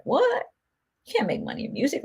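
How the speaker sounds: a worried parent scoffing at a music career; imagined shocked parent. Pretend. Parent hearing the music plan, startled disbelief. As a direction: pitch leaps high on a startled what, then drops back. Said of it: "I'd be like".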